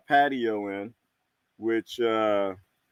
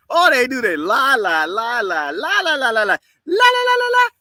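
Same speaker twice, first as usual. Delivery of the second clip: building shouted rant; 12 semitones higher, 6 dB louder than usual. Real, not acted. Ranting to stream chat about government lies, building shout. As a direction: chant it louder and higher until shouting.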